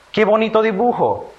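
high, admiring exclamation; exclamation of emotion. Shown as a demonstration. Teacher reads a sentence for children to classify, exclaiming high with admiration. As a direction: exclaim with delight, voice lifted high. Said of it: "estoy expresando una emoción".

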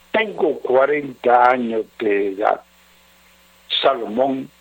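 low, subdued letter-reading voice. Acted. Radio adviser reading a troubled husband's letter, low and measured. Reads listener's letter aloud.

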